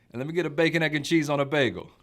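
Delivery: loud, high New York deli order; New York accent. Retold or imitated. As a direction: order breakfast like a brash New Yorker. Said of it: "your New York accent you use up there".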